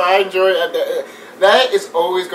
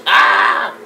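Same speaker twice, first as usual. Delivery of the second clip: loud mock scream; short, loud yelp as if tased; imitated tased yell; 4 semitones higher, 7 dB louder than usual. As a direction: imitate someone getting zapped. Imitates Scarecrow getting tased.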